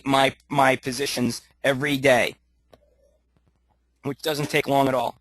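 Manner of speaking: normal talking voice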